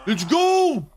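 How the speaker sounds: high, loud cheer; excited yell. Real, not acted. Gamer cheering during fight, high and loud. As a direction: belt it out in triumph.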